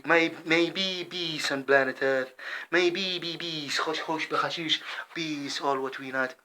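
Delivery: ordinary delivery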